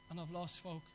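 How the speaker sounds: low and soft, a quiet admission; dejected low murmur. Retold or imitated. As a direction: let the words drop, defeated. Preacher voicing a tempted person.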